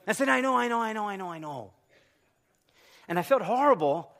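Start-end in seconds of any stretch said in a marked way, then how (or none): 0.3-1.7 rapid repeats falling from high; rueful concession. Retold or imitated. Husband getting his own lecture back, sheepish surrender. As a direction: start high and run down in defeat.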